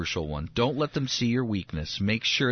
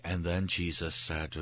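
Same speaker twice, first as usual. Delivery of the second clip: softer, slow, lifeless reading voice; dull scripture reading; 5 semitones lower, 5 dB quieter, slower than usual. Pretend. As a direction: read it like the most boring devotional. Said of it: "I'm not as entertaining".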